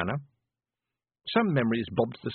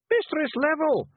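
very high, loud Feegle yell; 5 dB louder than usual. Acted. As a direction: yell to wake her. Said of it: "Rob Anybody yelled".

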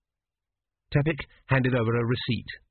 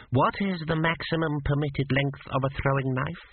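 curt, clipped examiner question; snapped question; 4 semitones higher than usual. A narrator's reading. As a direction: snap it out like a stern examiner. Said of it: "snapped Meriset".

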